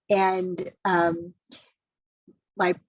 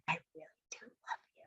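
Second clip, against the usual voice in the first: retold whisper; 4 semitones lower, 18 dB quieter, much faster than usual. Tender moment retold.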